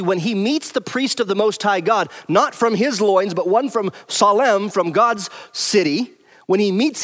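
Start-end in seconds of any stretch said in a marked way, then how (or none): none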